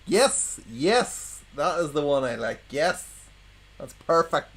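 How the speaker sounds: high, delighted laugh then yes. Real, not acted. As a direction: squeal with delight. Delight at the pun.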